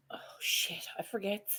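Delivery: whispered to himself; whispering. Real, not acted. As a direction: hush it, annoyed at forgetting. Muttered aside on forgetting.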